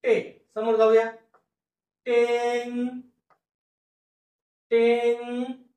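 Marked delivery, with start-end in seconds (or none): 2.1-5.6 टेन held long and high, then tent with ट detached; high, drawn-out sound-out of tent; broken-up slow pronunciation. Shown as a demonstration. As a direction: stretch tent slowly in parts. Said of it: "फोडून फोडून उच्चार लिहितो आणि हळूहळू".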